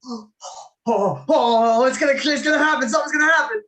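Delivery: high, loud, rushed anticipation; excited crowd. Retold or imitated. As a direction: imitate a crowd bursting with excitement. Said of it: "everyone was like".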